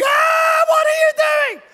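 very high, loud mock shriek; comic mother's alarm at piercing pitch; panicked mother. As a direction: shriek like a horrified mum, hammed-up. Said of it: "She's going".

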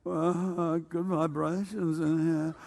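slow, soft, spaced-out hippie drawl; exaggerated hippie talk. Mocking how his hippie friend really talked, slow hippie drawl. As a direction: stretch it out like a dazed hippie. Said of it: "you didn't quite talk like that. You went".

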